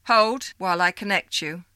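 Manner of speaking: stiff caller voice. Acted. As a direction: announce it like a switchboard. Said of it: "the voice only repeated".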